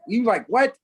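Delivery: high, loud, shocked question; appalled disbelief. Retold or imitated. New Yorkers learning he eats frog legs, high and appalled. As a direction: jump up in pitch, scandalised. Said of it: "they almost burned me at the stake".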